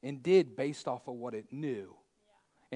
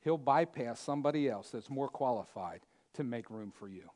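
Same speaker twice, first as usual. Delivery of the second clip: ordinary delivery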